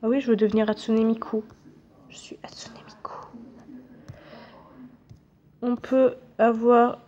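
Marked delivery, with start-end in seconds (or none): 2.1-3.1 whispered, very soft aside; barely-there whisper; hushed whisper. Pretend. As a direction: whisper it as a playful secret. Pretends to be Hatsune Miku.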